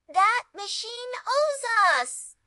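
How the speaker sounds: slow, high-pitched boastful voice; scheming boast. A narrator's reading.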